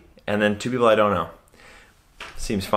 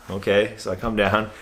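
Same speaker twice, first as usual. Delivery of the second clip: ordinary talk, nothing marked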